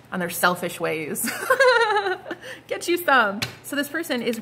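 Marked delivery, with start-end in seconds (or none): 1.4-2.6 a squealing high cackle; high laugh. Real, not acted. Tarot reader cracking up at her own sassy remark.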